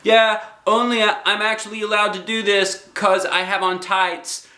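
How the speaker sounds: put-on high, bouncy kid voice; little girl Junie B. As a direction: play a bossy six-year-old.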